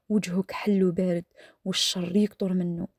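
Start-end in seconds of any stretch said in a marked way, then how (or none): none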